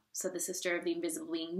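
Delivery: plain reading voice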